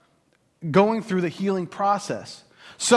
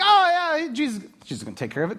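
breezy dismissive; 7 semitones higher, faster than usual. Retold or imitated. Voicing casual readers.

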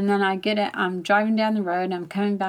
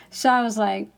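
ordinary delivery

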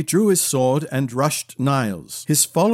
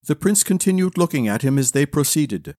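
usual speaking voice

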